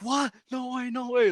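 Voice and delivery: high-pitched schoolkid amazement; joyful disbelief, retold. Retelling students learning school is canceled, joyful disbelief. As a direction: replay happy shock in a high voice. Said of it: "we're just full of joy".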